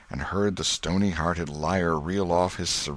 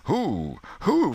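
crowd asking; 11 semitones higher than usual. Acted. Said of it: "from twenty voices".

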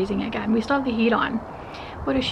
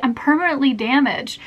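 normal talking voice

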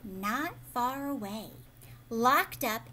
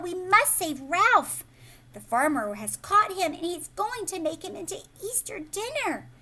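loud, high and urgent; calling out urgently; 7 semitones higher, 6 dB louder than usual. A narrator's reading. Liza calling to her mother, loud and urgent. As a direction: shout the news to mama.

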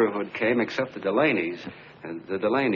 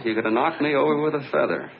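ordinary talk

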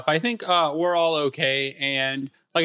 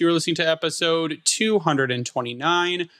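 high-pitched opening greeting settling toward usual pitch; podcast intro; 6 semitones higher than usual. Acted. Host welcoming listeners to a new episode, show-opener voice.

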